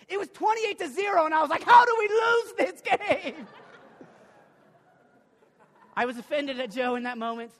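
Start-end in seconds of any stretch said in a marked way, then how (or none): 0.1-3.5 incredulous laughing shout. Retold or imitated. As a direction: shout it as a fan who can't believe it. Disbelief at the score.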